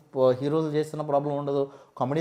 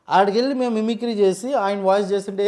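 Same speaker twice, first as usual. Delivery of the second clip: normal talking voice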